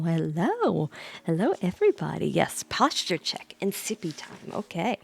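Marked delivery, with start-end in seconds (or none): none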